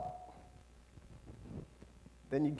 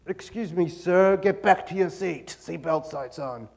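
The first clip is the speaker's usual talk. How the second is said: crabby stewardess. Acted. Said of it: "They're seventy-year-old crabby, mean".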